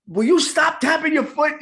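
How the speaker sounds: high shouted complaint from the wife; wife's mock scolding. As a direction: snap at him over the tapping. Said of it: "My wife is like".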